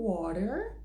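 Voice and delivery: WATER in a Californian accent, final R sounded. Shown as a demonstration. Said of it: "mais da Califórnia".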